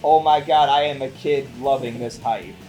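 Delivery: ordinary delivery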